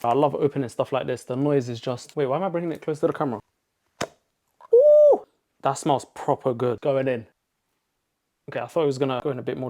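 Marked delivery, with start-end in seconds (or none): 4.8-5.2 loud, very high-pitched whoop; delighted high "ooh". Real, not acted. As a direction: let out a sustained high "ooh" at the smell. Reacting to a good smell.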